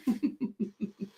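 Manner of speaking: soft trailing chuckle; laugh. Real, not acted. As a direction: chuckle after your own singing. Laughs after her own singing.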